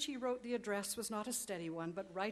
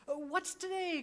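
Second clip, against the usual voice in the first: higher, eager cry out the window; joyful cry; 9 semitones higher, 5 dB louder than usual. Acted. Scrooge calling down to a boy in the street, joyful cry. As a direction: call out with giddy joy. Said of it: "cried Scrooge".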